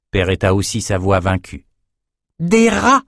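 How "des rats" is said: eager exclamation. A narrator's reading. Ambre hitting on the solution, high excited cry. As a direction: blurt out the discovery. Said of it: "lança Ambre".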